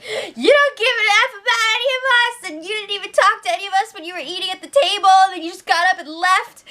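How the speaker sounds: high, fast mock tearful outburst; imitating cry yelling. Imitating Chrissy cry-yelling at Justin, high and tearful. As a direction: wail it like a tearful rant, hammed-up. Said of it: "she's cry yelling".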